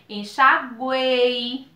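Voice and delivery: "enxaguei" arrastado sílaba por sílaba; palavra bem lenta. Shown as a demonstration. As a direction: diga "enxaguei" bem devagar. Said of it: "como o caso em enxaguou". ("enxaguei" falado lentamente)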